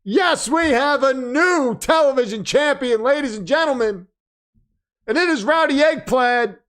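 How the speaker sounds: hype announcer. Acted. Announces new champion to stream.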